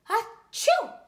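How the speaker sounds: loud high achoo with a rise; a sick boy sneezing in the story; big sneeze. A narrator's reading. Josh sneezes.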